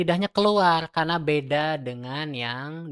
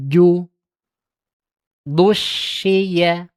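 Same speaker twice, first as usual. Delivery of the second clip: loud chanted syllable drill; tajwid recitation drill; 3 semitones higher, 6 dB louder, much slower than usual. Shown as a demonstration. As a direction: recite each syllable clearly. (latihan makhraj dengan suara lantang)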